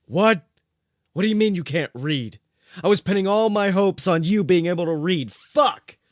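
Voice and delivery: high, loud furious outburst. A narrator's reading. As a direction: blow up in frustration. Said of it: "Dean was furious".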